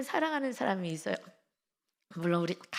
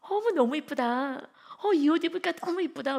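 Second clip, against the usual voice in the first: loud, high delighted compliment; gushing praise; 7 semitones higher, 5 dB louder than usual. Shown as a demonstration. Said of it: "그냥". (크고 높게 감탄하는 칭찬)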